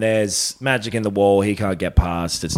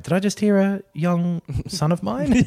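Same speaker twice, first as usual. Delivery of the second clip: higher mock Ned voice; 8 semitones higher than usual.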